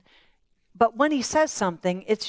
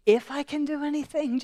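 higher, amused echo of the doubt; incredulous Jesus; 5 semitones higher than usual. Acted. Jesus echoing the father's doubt, higher and amused. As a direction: throw his words back, half laughing.

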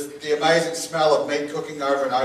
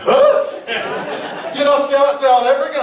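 high, loud mock disbelief; loudly imitating someone who can't believe it; incredulous; 11 semitones higher, 5 dB louder than usual. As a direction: act out a startled double-take. Acted reply to learning he's Kiwi.

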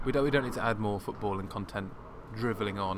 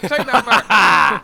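slow, much louder and higher laughing demand; mock outraged; 10 semitones higher, 15 dB louder, much slower than usual. Son defending his dad's honour, laughing mock outrage. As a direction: bellow it in playful outrage.

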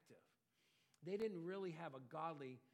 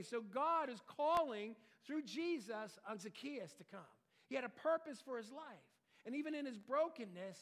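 raised, louder preaching voice with strong emphasis; fervent preaching; 6 semitones higher, 7 dB louder than usual. Acted. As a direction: preach it out to the congregation. Preaching at full force.

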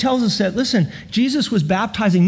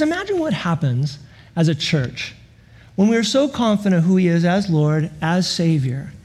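usual speaking voice

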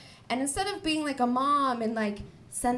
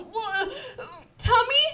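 put-on high, slow crying-laughing toddler voice; little kid voice; 12 semitones higher, 6 dB louder, much slower than usual. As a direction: imitate a scared little kid puking. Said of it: "and he goes".

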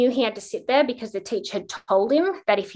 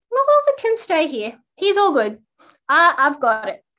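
bright insistent reassurance; 7 semitones higher, 6 dB louder than usual. Retold or imitated. Mother cheerfully overriding the teacher.

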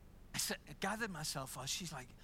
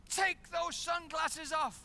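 stern order; 10 semitones higher, 6 dB louder, slower than usual. Retold or imitated. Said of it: "She goes".

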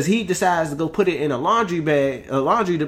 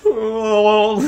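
crowd groan; 8 semitones higher, 6 dB louder than usual. Retold or imitated.

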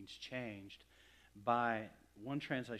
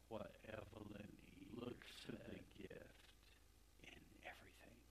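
hushed, tender whisper; 13 dB quieter than usual. Real, not acted. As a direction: drop to a whisper as he remembers.

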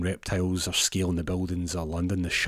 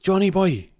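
calling out; 12 semitones higher, 8 dB louder than usual. Retold or imitated. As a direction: shout across the room. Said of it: "he says to one of the elves".

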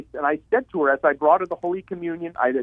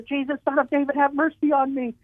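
much higher straining plea, level not raised; a blind man calling out higher still, begging; screamed even louder; 10 semitones higher than usual. Retold or imitated. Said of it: "he screamed even louder".

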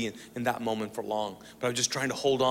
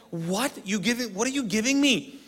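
high-pitched comic disbelief; incredulous; 8 semitones higher than usual. Retold or imitated. Handed a tiny Bible by his brother, incredulous. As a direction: question it as if you've been cheated.

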